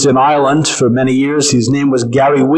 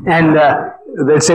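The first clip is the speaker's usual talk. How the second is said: ordinary delivery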